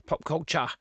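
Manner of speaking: Australian accent, pitch lifted on Pop culture; broad Australian. Shown as a demonstration. Host repeating a phrase in exaggerated Australian accent. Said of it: "Sounds so Australian".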